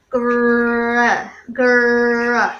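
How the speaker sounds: grrr rasped at the back of the throat; uvular trill. Shown as a demonstration. Showing the class a French R, throaty grrr. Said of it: "French or German are the".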